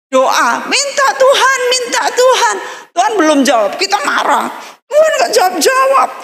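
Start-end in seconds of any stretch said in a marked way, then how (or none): 0.7-2.6 put-on high, pleading kid voice; whining child prayer. Child begging God in prayer. 4.9-6.1 put-on sulky child. Child angry at God.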